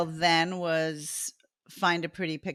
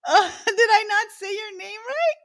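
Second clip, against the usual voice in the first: laughing; 8 dB louder than usual. Real, not acted. Laughing through embarrassment.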